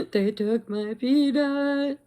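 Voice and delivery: slow, high, loud lament; mock sad wailing. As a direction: wail it like a hammed-up tragedy. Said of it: "I'm so sad".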